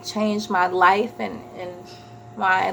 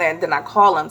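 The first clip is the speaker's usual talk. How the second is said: ordinary delivery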